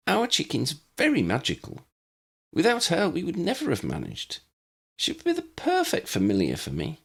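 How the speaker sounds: put-on bright, eager girl pleading her case, pitched high; a young witch keen to keep her chicken; young Lydia voice. A narrator's reading. Lydia's voice in narrated story.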